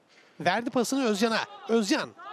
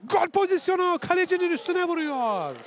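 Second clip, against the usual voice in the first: excited commentator; 6 semitones higher than usual. Real, not acted. Big chance live.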